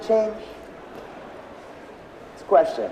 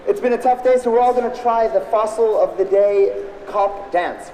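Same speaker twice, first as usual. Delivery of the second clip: usual speaking voice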